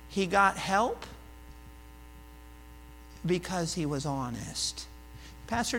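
ordinary delivery

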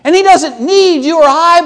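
very high, loud pulpit proclamation; full-force preaching. Acted. Preacher answering worried Christians, high and loud.

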